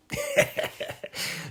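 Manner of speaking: wheezy voiceless laugh. Real, not acted. Comic amused at mocking the offended, soft laugh. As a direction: laugh softly at your own joke.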